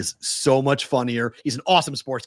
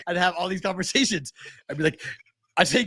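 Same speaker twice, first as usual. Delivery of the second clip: ordinary delivery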